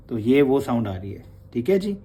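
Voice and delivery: ordinary talk, nothing marked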